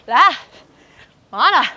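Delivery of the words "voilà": usual speaking voice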